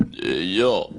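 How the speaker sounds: low mumbled yo, a hint of Stallone; lightly voiced Rocky grunt; a quick low Stallone bit; Stallone impression. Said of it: "Sylvester Stallone".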